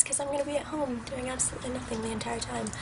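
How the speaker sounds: usual speaking voice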